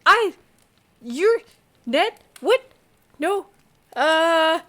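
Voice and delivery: high, loud, halting bursts; flustered stammering. Acted. Man with folders being yelled at, flustered stammering. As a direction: sputter single words, caught off guard.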